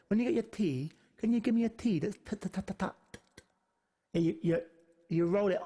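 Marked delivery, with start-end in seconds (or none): 2.2-4.2 a run of light, crisp "t" taps; crisp T demo. Shown as a demonstration. Teacher shows students the Spanish T, crisp tapping. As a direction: tap each "t" up behind the front teeth. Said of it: "up behind your front teeth".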